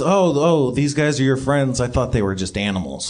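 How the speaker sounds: low dopey monster voice; deep Yeti voice. Acted. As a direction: rumble it like a friendly Yeti.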